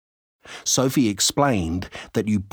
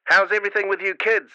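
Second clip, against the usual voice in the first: fast, cheerful mum over static; quick friendly call through a crackly radio; scratchy radio voice; 9 semitones higher, faster than usual. A narrator's reading. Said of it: "said the scratchy voice".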